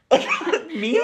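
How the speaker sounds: gasping, high, loud reenacted surprise; mock surprise. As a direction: act out being flattered and stunned, laughing. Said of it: "Why am I so surprised".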